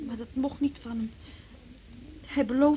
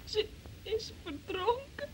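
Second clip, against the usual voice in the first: tearful, slow and soft, high and breaking; fighting back tears as she tells it; crying; 7 semitones higher, 3 dB quieter, slower than usual. Acted. Niece reports aunt drowned. (huilend, zacht en gebroken)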